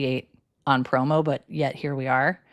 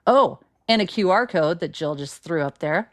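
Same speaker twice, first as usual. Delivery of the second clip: ordinary delivery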